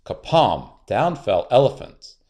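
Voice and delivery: ordinary delivery, nothing marked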